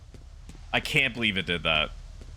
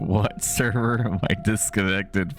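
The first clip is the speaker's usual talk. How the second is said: low, louder, slowing indignant rant; angry rant; 4 semitones lower, 4 dB louder, slower than usual. Real, not acted. Streamer ranting at the game after a disconnect, low and louder. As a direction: grumble your outrage at the screen, dragging it out.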